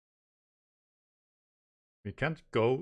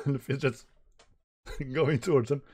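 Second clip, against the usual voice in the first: usual speaking voice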